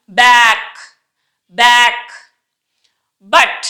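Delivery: firm B opening BACK and BUT; separate, carefully modelled words; emphatic B. Shown as a demonstration. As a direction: hit the B in బ్యాక్ hard. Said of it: "బి అనేది చాలా ఒత్తి పలుకుతున్నాం".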